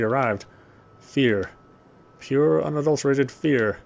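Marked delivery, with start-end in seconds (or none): none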